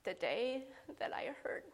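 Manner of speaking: whispered, choked up; tearful whisper. Real, not acted. Breaking down telling heartache.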